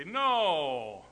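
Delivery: lightly imitated, drawn-out refusal; reluctant daughters. Dad imitating his daughters turning down catch, lightly voiced refusal. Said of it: "all my daughters would say".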